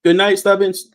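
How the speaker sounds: loud cheery goodnight. Real, not acted. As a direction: call it out brightly to chat. Echoing goodnight, louder and higher.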